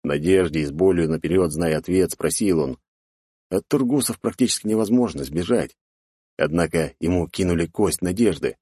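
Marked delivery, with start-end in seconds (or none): none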